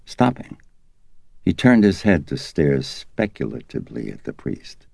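usual speaking voice

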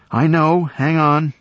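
loud high reassurance; calling down. A narrator's reading. Sarah calling down to Peter, loud reassurance. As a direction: call down to steady him.

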